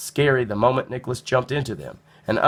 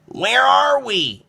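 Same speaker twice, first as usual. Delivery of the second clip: high, slow, awed question; boy amazed on a beach, slow and high; in amazement; 14 semitones higher, 6 dB louder, much slower than usual. A narrator's reading. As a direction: marvel at a strange new place. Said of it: "asked Nicholas in amazement".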